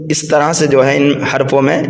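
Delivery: ordinary delivery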